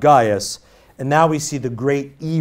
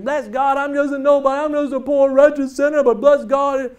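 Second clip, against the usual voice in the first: much higher, hammed-up preacher's self-debasement; mock the pious "I'm just a nobody" show; showy false humility; 10 semitones higher than usual. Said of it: "That person that gets up there".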